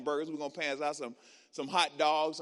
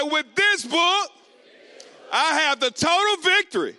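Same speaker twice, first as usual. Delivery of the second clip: shouted declaration; 10 semitones higher, 12 dB louder than usual. Shown as a demonstration. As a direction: shout it like you mean it. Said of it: "say it like you mean it".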